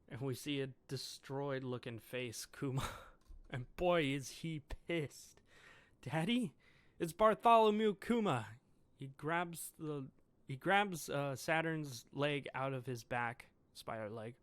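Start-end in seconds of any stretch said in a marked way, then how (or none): 6.0-8.5 joyful cry. Acted. Bonnie recognises her father.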